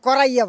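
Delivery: কড়াইয়া said the Dhakaiya way, with an -iya ending; Dhaka dialect word. Shown as a demonstration. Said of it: "ঢাকায় ভাষা কড়াইয়া বলে". (ঢাকাইয়া উচ্চারণে কড়াইয়া)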